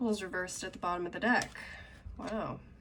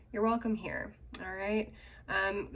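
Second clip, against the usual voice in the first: usual speaking voice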